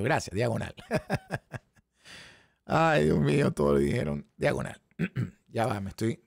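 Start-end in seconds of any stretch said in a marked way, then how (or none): 0.0-2.6 laughing. Real, not acted. Laughing at the chat's correction.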